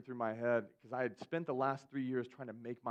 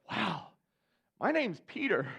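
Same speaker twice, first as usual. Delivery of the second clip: higher, louder offended surprise; jokingly wounded; mock offended; 7 semitones higher, 6 dB louder, slower than usual. Peter's hurt reaction, joke.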